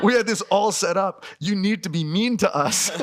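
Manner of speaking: high, giggling demand to be treated badly; laughing plea. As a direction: plead through laughter. Pleading with nice waiter.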